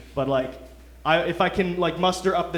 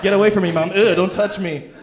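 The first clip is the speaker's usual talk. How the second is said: loud, higher mock brat snapping at a parent; disgusted snapping; 4 semitones higher, 8 dB louder than usual. Poor kid to his broke mom, hammed-up disgusted snapping. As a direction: act repulsed, shove them off with your voice. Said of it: "That's how I talk to my mom".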